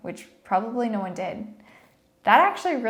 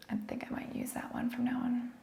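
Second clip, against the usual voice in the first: whisper; 3 semitones higher, 12 dB quieter, faster than usual. Real, not acted. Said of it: "whispered aside after the joke".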